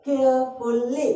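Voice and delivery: Carefully slowly as केयर-फुल-ली; three separate syllables. Shown as a demonstration. Teacher repeats the split, carefully syllable by syllable. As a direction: give each part of carefully its own beat. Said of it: "ये तीन syllable में टूट रहा है".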